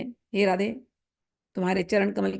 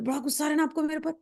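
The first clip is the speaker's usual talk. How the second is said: soft coaxing; 4 semitones higher, 6 dB quieter, faster than usual. Acted. Coaxing an angry beloved.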